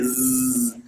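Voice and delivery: voiced buzzing like a bee. Shown as a demonstration. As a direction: buzz like a bee for a child. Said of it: "O som da abelha?".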